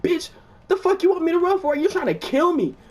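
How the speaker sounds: high, loud disbelieving yell; retold indignant outburst; incredulous yell. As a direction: shout it like he's lost his mind. Accusing Ross of trying to kill.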